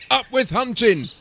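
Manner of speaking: high, loud rallying chant; lusty storybook battle cry; shouting. A narrator's reading. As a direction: shout it as a hunting slogan. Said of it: "they shouted".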